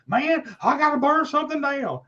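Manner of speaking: high mock desperate outburst; imitated meltdown. Friend retelling the fan's urge to burn something, mock frantic and high. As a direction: play a fan who has lost it. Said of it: "he was losing his shit".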